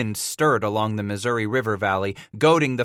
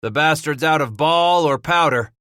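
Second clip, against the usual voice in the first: loud raised call; announced; 5 semitones higher, 5 dB louder than usual. Acted. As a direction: announce it loudly. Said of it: "Bass announced".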